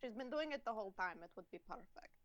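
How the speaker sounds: put-on Eastern European accent; Copper. Player showing a character from Barovia, Eastern European accent. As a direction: speak as a vampire-land native, Eastern European accent. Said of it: "That's my voice for Copper".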